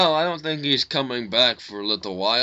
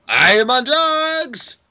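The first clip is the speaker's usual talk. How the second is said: mock outburst; 9 semitones higher, 5 dB louder, slower than usual. Joking outburst.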